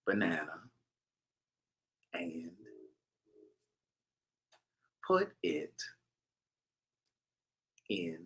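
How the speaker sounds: teasing slow-motion recipe read, whispery and low; exaggerated slow dictation. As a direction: drag each word out with long pauses. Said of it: "I'm gonna say it really slowly".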